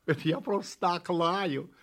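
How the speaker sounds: ordinary delivery, nothing marked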